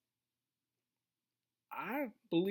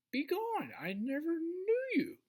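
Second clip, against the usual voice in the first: raised pitch on a quoted dismissal; stern rebuke, quoted; 8 semitones higher than usual. Retold or imitated. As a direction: send them away as if quoting a rebuke. Quoting Jesus turning people away.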